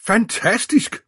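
very high, loud, rising outburst; amazed exclamation. A narrator's reading. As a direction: burst out in incredulous delight. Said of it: "udbrød han". (højt, begejstret udbrud)

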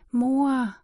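lifted, drawn call; calling for mother. Acted. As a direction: try to rouse your sleeping mother. Child shakes mother, calls her.

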